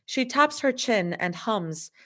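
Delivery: plain reading voice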